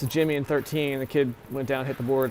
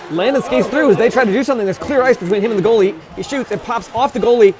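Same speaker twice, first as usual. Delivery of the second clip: louder, higher, building on the breakaway; excited play-by-play; 7 semitones higher, 8 dB louder than usual. Real, not acted. Commentator getting worked up on the breakaway. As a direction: ride the rush toward the goalie. Breakaway chance called live.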